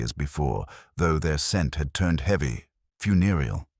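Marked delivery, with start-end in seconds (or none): none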